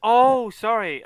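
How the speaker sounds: loud, drawn-out oh of realization; sudden realization. Real, not acted. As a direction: realize it all at once. Realizes mistake mid-game.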